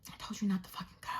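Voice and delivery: fast, very soft hissed reproach; exasperated whisper. Retold or imitated. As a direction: whisper it fast under your breath, fed up. Exasperated muttering about other driver.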